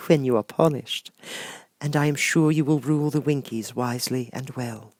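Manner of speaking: high, gentle sorceress voice; kind witch Glinda. Acted. Glinda promising Dorothy a way home, high and gentle. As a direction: soothe the child like a kind fairy.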